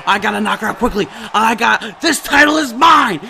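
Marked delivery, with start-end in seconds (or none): 2.0-3.2 excited shout. Real, not acted. Gamer yelling at the screen that the title is mine. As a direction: shout it loud, claiming the win.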